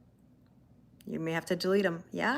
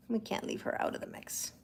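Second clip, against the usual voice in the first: playful whisper; 9 dB quieter than usual. Shown as a demonstration. Repeats line in new voices.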